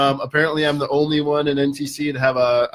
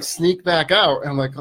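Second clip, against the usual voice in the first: ordinary talk, nothing marked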